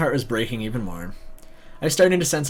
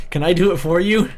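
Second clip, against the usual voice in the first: 1 loud joking offer; 6 semitones higher, 7 dB louder than usual. Real, not acted. Joking aside offering to punch.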